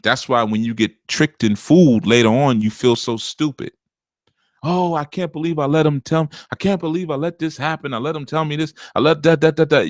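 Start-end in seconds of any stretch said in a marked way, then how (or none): 4.6-9.9 high whiny imitation of a woman kicking herself; hammed-up lament, voice pushed up; mocking regretful woman. Host mimics a fooled woman scolding herself, mock whiny and high.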